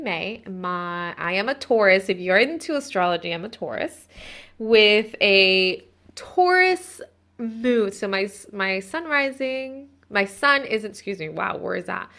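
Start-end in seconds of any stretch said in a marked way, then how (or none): 4.6-7.9 drawn-out emphasis. Real, not acted.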